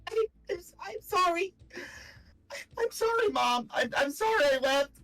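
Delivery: very high, sobbing, broken apology; crying apology. Acted. Phelan sobbing her apology to Briar, high and tearful. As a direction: sob out the apology in a high, cracking voice. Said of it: "wow, I can't do the voice".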